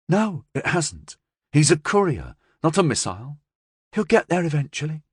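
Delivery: high-pitched woman briskly reassuring her boss; confident gangster's partner. A narrator's reading.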